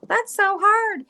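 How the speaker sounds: high, slow mock whine; hammed-up whining guest; whiny complaint. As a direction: imitate someone stumped by a question. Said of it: "they're like".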